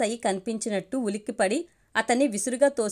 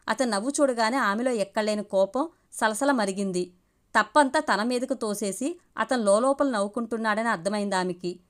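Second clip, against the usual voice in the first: normal talking voice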